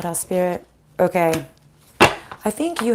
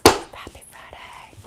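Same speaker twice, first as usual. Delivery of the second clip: breathy whispered greeting, slowed down; soft slow whisper to the camera; 4 dB quieter, slower than usual. Shown as a demonstration. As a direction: whisper it like a little secret. Greeting viewers, then whispered repeat.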